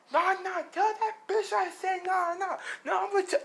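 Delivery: very high, strained mock outrage; acted hothead refusing to back off; worked-up refusal. Bak refusing to calm down.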